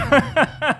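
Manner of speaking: a quick, high laugh; high burst of laughter. Real, not acted. Mocking a commentator's face, a short high laugh.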